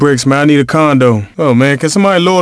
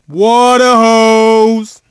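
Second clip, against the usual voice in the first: long, stretched-out hype call; drawn-out cheer; 7 semitones higher, much slower than usual. Acted. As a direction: stretch out the shout slowly. Trash talk after a made shot.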